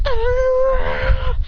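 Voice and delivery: high, sustained charging yell; battle yell. Acted. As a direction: hold a high mock war cry through the attack. Battle cry sliding under foe.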